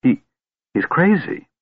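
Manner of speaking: frightened gasp. A narrator's reading. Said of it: "Tass gasped".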